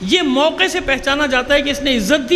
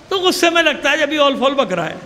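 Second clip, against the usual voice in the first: usual speaking voice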